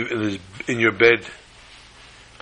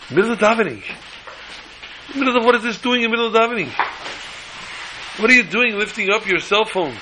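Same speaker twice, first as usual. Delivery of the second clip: indignant rebuke; 7 semitones higher, faster than usual. Real, not acted. Rebuking phone use in prayer.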